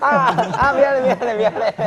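high, loud laughing apology; hammed-up mock pleading; frantic apologizing. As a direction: blurt out playful sorries in a panic. Mock apology after teasing, amid laughter.

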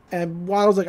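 normal talking voice

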